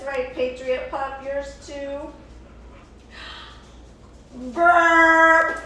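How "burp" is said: big burp. Acted. Puppet burps loudly.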